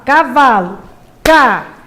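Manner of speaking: "cavalo" broken into separate, loud syllables; "cavalo" with each syllable set apart. Shown as a demonstration. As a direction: say "cavalo", then clap it out syllable by syllable. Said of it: "bater palma, né, a cada sílaba".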